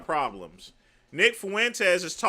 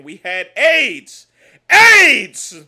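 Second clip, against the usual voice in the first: rising to a full-throated yell; building shout; 3 semitones higher, 15 dB louder, much slower than usual. Acted. Comic ranting to his audience, building to a roaring shout. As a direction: start loud, then explode on the last word.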